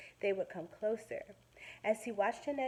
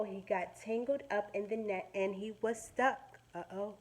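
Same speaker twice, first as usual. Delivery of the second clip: usual speaking voice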